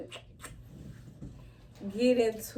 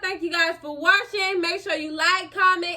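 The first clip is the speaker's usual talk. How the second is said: high, singsong outro to viewers; peppy high-pitched YouTuber outro; vlog sign-off; 9 semitones higher, 7 dB louder, slower than usual. Acted. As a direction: sign off high with stretched line endings.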